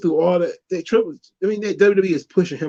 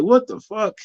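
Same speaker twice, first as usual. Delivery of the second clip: shocked disbelief; 7 semitones higher than usual. Retold or imitated. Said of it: "You're like".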